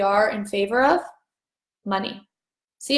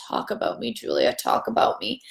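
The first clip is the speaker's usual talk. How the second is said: voice of Money; 5 dB quieter than usual. Pretend. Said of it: "Money was just talking to me and was like".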